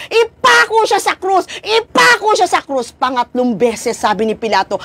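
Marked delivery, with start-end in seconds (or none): 0.1-2.9 high, urgent mock crowd shout. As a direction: voice the mob calling for the cross. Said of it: "Sumigaw na naman ang tao". (sigaw ng mga tao, ginagaya)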